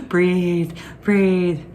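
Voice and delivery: normal talking voice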